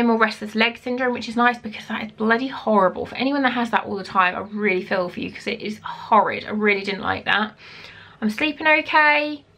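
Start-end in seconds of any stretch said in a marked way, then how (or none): none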